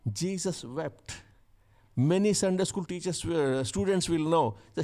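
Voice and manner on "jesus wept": whispered sorrow, much softer and slow; hushed, slow. Acted. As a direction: let it fall away, barely audible. Solemn telling of Jesus weeping.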